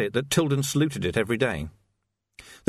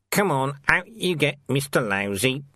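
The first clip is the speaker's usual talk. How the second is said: gruff jailer; 3 semitones higher than usual. A narrator's reading. Jailer hustles old man out.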